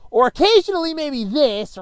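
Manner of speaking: loud, high-pitched yell. Shown as a demonstration. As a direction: demonstrate how loud you get, voice jumping up. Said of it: "talking any louder than this".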